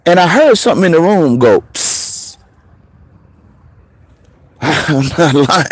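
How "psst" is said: a soft unvoiced psst; imitated hiss. Musician retelling the spooky room, a whispered psst. Ghostly sound from the corpse room.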